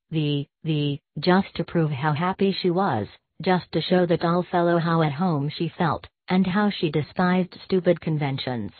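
usual speaking voice

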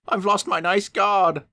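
high, mildly tearful coach voice; a touch of a sob in it; lightly acted weepy wail; mock sobbing. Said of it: "she sobbed".

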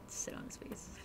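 very soft voiceless whisper; hushed, barely-there aside. Real, not acted. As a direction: whisper it like a naughty secret. Naughty aside about game character.

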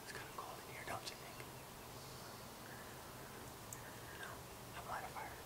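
a soft whisper; hushed conspiratorial whisper to a friend. Acted. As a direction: whisper it so Corey stays asleep. Whispered prank setup at night.

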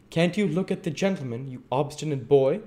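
high, quick, loud telling-off; scolding. Acted. Missus Mann to the cowering Dick, scolding. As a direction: rap out a reprimand to a child.

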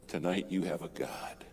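soft, breathy near whisper; hushed. Real, not acted. Preacher closing to congregation, hushed near whisper. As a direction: let the promise sink in quietly.

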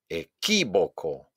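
slow "Equívoco", punched on QUÍ; exaggerated second-syllable stress. Shown as a demonstration. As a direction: hit QUÍ in a stretched "Equívoco". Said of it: "exagerar la pronunciación". ("Equívoco" lento, con golpe en QUÍ)

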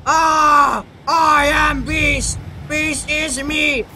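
slow roaring beast voice. Pretend. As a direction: roar like a monster. Creature announces itself.

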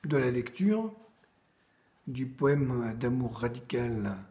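usual speaking voice

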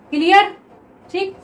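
normal talking voice

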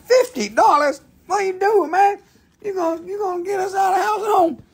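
high, squeaky put-on voice of the girlfriend; mock Nicole voice. Girlfriend scolding him over the price, in a high mock voice. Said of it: "Nicole's rolling her eyes over there".

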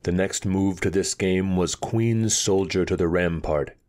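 plain reading voice

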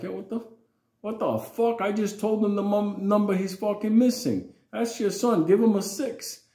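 high, loud exasperated protest; father demanding why it matters; indignant father. Retold or imitated. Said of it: "my father was like".

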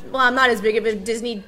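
normal talking voice

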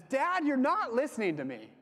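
daughter's protest. Retold or imitated. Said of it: "she says".

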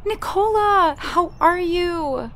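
high, loud, drawn-out hello; excited greeting. Acted. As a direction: call her name with open arms. Friend greets Nicola.

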